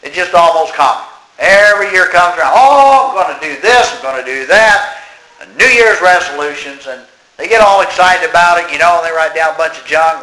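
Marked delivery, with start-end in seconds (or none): 2.5-5.0 high, loud "Oh" settling into mimicked eagerness; mock excitement. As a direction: parody someone gushing over new plans. Mocks excited New Year resolvers.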